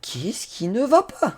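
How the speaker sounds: high, slow, drawn-out cry of exasperation; comic despair, pitched well above normal talk; exasperated wail. Real, not acted. Exasperated as the retry fails.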